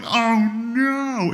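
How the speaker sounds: high, loud horrified "oh no"; dismayed cry. Retold or imitated. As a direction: gasp it out in shock. Watching the ball hit her face.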